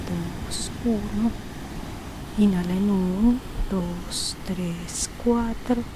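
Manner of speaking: soft, slow counting with whispered exhales; slow breath counting. Acted. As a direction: count each breath gently and unhurried.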